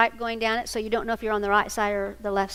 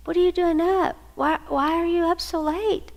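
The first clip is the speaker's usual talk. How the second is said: concerned son; 6 semitones higher than usual. Retold or imitated. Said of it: "He comes in and goes".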